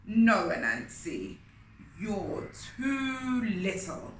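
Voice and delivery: loud, slow scornful refusal; dismissive lion king. Acted. King Lion dismissing Anansi, slow loud scorn. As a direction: put him down from the throne.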